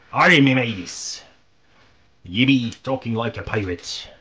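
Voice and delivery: pirate accent. Shown as a demonstration. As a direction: growl it out like a stock movie pirate. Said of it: "The distinct accent that pirates have".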